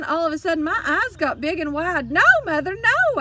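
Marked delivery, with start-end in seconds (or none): 2.1-3.2 much higher, louder pleading cry; girl crying out so her parrot won't be cut; shouting. A narrator's reading. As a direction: yell it to stop her mother, alarmed. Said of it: "I shouted".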